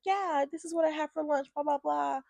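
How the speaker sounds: high, soft small-talk voice; mock her idle chatter in a light high voice; mimicked chatty officer.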